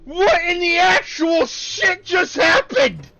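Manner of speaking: slow, loud, high shout; angry shout. Real, not acted. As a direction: bellow in disbelief. Card game goes wrong.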